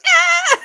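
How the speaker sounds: sharp high squeal; imitated pig scream. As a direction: mimic a little pig's shriek. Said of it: "And she goes".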